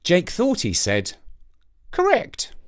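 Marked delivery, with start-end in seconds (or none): 1.9-2.5 high cawed word; raspy cawing crow. Acted. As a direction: caw the word out. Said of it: "this time it sounded like a word".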